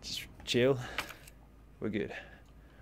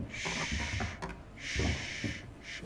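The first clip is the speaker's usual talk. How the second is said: shushing; 7 semitones lower, 9 dB quieter, much slower than usual. Real, not acted. Hushing companions quietly.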